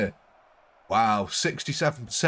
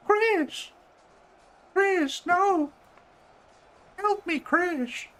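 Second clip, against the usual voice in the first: mock cry for help; much slower than usual.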